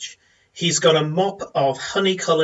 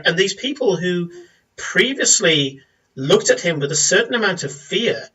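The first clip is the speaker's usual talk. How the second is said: normal talking voice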